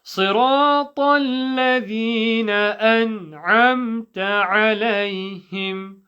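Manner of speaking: measured, melodic Quranic chant; nice and slowly. Shown as a demonstration. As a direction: lead students slowly through the verse. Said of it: "nice and slowly".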